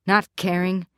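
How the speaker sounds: ordinary delivery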